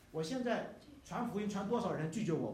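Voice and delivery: ordinary delivery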